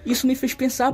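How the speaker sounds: ordinary talk, nothing marked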